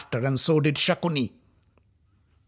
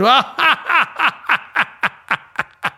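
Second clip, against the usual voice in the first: high loud villainous cackle; evil laugh; 13 semitones higher, 6 dB louder than usual. Acted. Dussasana laughs at the dragged queen, cruel cackle. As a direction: laugh cruelly at her pain. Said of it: "Dussasana laughed and said".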